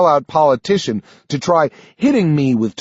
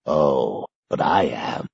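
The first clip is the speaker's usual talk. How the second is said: put-on low, softer frail old man, final; quietly decided, giving up; old Sanderson; 11 semitones lower, 5 dB quieter, slower than usual. A narrator's reading. Old man refusing to fight on, low and soft. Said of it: "Sanderson replies".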